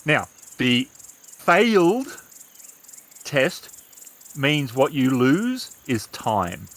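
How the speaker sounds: ordinary delivery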